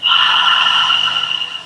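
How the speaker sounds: voiceless whooshing exhale; long out-breath of release; long breathy sigh. Shown as a demonstration. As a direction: let all the breath go slowly. Exhaling after squeezing shoulder blades.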